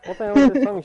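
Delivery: loud, high-pitched incredulous outburst; mock disbelief. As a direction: blurt it loudly in exaggerated disbelief. Acting out stunned inner reaction.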